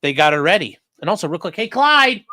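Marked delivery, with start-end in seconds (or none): 1.5-2.2 loud, high call to the dog; hey-there dog call. Real, not acted. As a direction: call the dog's name across the room. Calling the dog.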